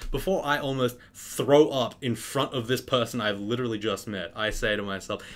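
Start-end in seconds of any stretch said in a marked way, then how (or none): none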